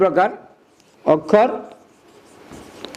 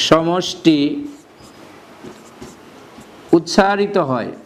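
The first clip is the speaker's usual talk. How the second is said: word by word with long pauses; slow dictation; slower than usual. Shown as a demonstration. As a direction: dictate each word slowly for notes. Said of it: "teacher dictating definition slowly". (ধীরে ধীরে শব্দে শব্দে লেখানো)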